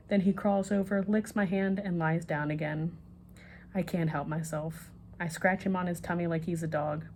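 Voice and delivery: ordinary delivery, nothing marked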